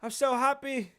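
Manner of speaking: high-pitched, hammed-up happiness; mock a prince insisting he is delighted; put-on, over-cheerful prince voice; Harry impression. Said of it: "I'll be Harry".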